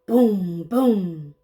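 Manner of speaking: ordinary delivery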